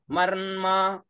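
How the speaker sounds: मर्मा with half म joined to full मा; मर्मा, half m then full ma; pronunciation demo. Shown as a demonstration. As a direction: say मर्मा as मर्-मा, the र riding on मा. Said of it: "म आधा म और पूरा मा".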